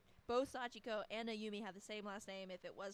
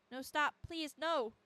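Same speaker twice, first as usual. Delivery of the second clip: loud, high frightened protest; acted terrified plea; panicked begging; 5 semitones higher, 8 dB louder, slower than usual. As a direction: cry out to stop an attacker. Acts victim begging attacker.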